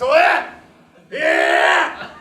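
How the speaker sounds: loud yelping animal screams; mock deer howl; deer screaming. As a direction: yell like the attacked deer. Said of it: "that's just".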